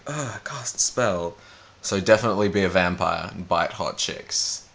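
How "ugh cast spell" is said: slow, lazy mock wizard grunt; bored RPG wizard. Mock RPG wizard slowly casting a spell. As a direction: drag out a dull spell call. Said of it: "the R P G guy who's like".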